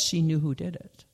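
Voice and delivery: plain reading voice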